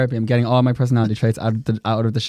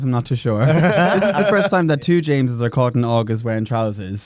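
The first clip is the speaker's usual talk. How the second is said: ordinary talk, nothing marked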